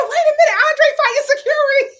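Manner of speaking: high, laughing in disbelief; real laughing exclamation; laughing shout. Real, not acted. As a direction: shriek it while cracking up.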